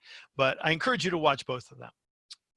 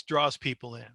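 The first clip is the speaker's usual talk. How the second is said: normal talking voice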